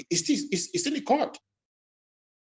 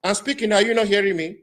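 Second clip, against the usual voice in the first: speaking up louder; 3 semitones higher, 7 dB louder than usual. Real, not acted. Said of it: "Prof, can you speak up?".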